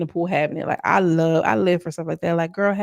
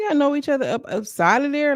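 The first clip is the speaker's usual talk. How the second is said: higher-pitched incredulous question; mock disbelief; 7 semitones higher than usual. Vlogger retells her reaction to them knowing each other, incredulous. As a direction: question it with exaggerated surprise. Acted incredulous reply in retold chat.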